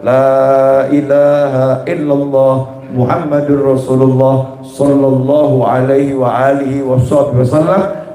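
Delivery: slow, measured dhikr recitation; lantunan wirid. Retold or imitated. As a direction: recite it slowly on a level, low pitch. Mencontohkan wirid harian sang habib. (lantunan zikir yang pelan dan rendah)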